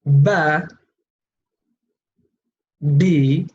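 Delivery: "Ba, bi, bu" as separate drawn-out syllables; letter with three vowels. Shown as a demonstration. Teacher for students to repeat aloud, syllable drill on "Ba, bi, bu". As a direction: drill "Ba, bi, bu", pausing after each syllable. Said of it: "pronounce all the letters with all three sounds".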